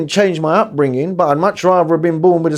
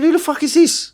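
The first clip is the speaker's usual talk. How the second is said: high-pitched retold disbelief; baffled, voice jumping right up; incredulous; 12 semitones higher than usual. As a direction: replay your disbelief, pitched way up. Retelling his baffled reaction on air.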